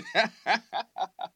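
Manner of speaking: high, short laugh at himself; sheepish chuckle; embarrassed laugh. Real, not acted. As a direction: laugh off the slip. Laughs at own blunder.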